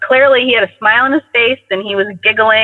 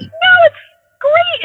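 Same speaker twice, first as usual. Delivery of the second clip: gleeful insistence; slower than usual. Retold or imitated. Telling her cold partner the backyard is great, gleefully high. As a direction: insist with delighted glee. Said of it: "I'm like".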